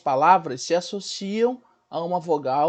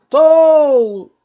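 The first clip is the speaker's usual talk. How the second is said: "tou" bem forte e agudo, escorregando pro U fraco; desça de "tou" como numa ladeira; ladeira descendo; 8 semitones higher, 12 dB louder than usual. Shown as a demonstration. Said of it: "uma ladeira descendo". ("tou" longo, O forte e U sumindo)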